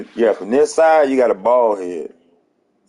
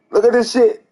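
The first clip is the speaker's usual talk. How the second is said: high squealed look at this; high disbelief; 11 semitones higher than usual. Real, not acted. Roasting the haircut, high squeal.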